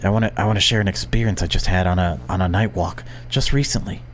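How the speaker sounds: normal talking voice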